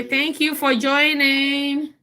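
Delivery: put-on lifted high prompt for the child; sing-song prompt. Shown as a demonstration. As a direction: model it brightly for him to copy.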